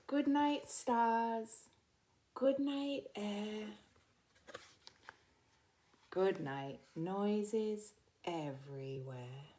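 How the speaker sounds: slow, gentle bedtime story read; soothing picture book reading; reading to children. Acted.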